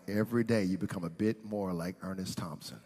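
very soft, low-pitched voice; low wry remark. Retold or imitated. Wife comments on husband turning dramatic, quietly wry. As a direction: murmur it as an aside.

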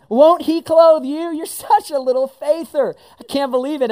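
high, loud, incredulous tease; mock disbelief. Jesus mock-amazed at his doubting friends, high and loud. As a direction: act amazed they still doubt. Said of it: "sounded a little bit more like this".

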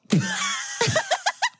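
a squealing, high-pitched laugh; high burst of laughter. Real, not acted. Podcaster laughing at calling an abusive movie calming, high laugh. Laughing at their own sarcasm.

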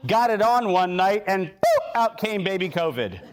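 ordinary delivery

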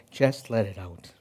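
very soft and low, barely voiced; soft soothing hush. Father soothing his crying son in his arms, a soft hush. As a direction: hush him gently as you rock him.

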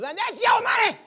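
high, loud, hitting YOUR; preacher's shout on your money. Acted. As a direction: call it out, stressing your.

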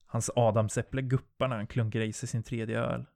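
plain reading voice

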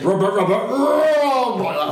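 high croaky frog voice. Retold or imitated. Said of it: "That's what it would say".

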